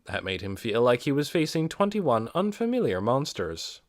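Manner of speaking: ordinary delivery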